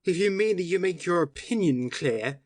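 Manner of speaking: cutting retort. Acted. Syme retorts to policeman.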